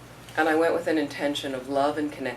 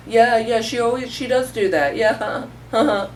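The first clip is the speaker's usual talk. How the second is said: loud, higher, giggly yes-man voice; mock a toadying coworker who laughs along; silly agreeing; 7 semitones higher, 7 dB louder than usual. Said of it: "saying it in really kinda stupid ways".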